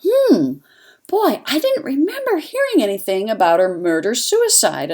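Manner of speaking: acted-out wondering to oneself; puzzled musing. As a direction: replay a mildly surprised thought. Retells puzzled thought at surprising result.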